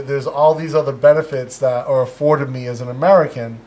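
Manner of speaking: normal talking voice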